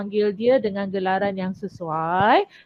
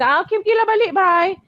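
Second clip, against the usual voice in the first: higher, louder careless brush-off; dismissive goodbye; 7 semitones higher, 5 dB louder than usual. Retold or imitated. Teacher mimicking child dismissing leaving guest, dismissive. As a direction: wave the guest off without caring. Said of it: "Kamu kata".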